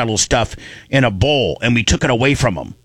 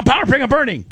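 wrong safe word; 11 semitones higher, faster than usual. Shown as a demonstration.

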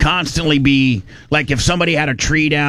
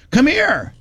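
calling out; 12 semitones higher than usual. Retold or imitated. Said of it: "She goes".

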